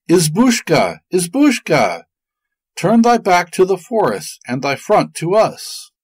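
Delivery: put-on high children's voices calling out; shrill childish call. A narrator's reading. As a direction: call out like small children at a hut. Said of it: "With their shrill, childish voices, they called out loud".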